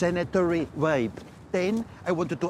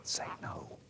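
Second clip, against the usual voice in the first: hushed whispered prompt; whispered coaching in a tense moment; 14 dB quieter than usual. Real, not acted. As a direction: whisper the answer to him. Prompting him secretly.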